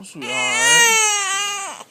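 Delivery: drawn-out high soothing call; slow high comforting coo; soothing sweetheart. Real, not acted. Comforting crying baby.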